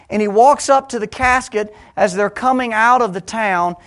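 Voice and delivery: loud, high, driving sermon voice; full-force preaching. Acted. Preacher stirring his congregation, full-force preaching. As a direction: preach it out to the whole room.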